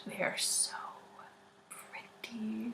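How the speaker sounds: breathless whispered gush; awed whisper. Real, not acted. Showing tattoos to listeners, awed whisper. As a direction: whisper it as if in awe of them. Gushing over pretty tattoos.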